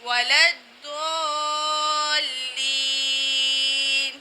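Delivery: وَلَا الضَّالِّينَ chanted slowly with a thick, rounded "Doo"; heavy Dad. Shown as a demonstration. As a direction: hold the heavy ض very long in الضَّالِّينَ. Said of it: "یہاں ہم ضاد ادا کر رہے ہیں". (موٹے ضاد سے کھینچ کر تلاوت)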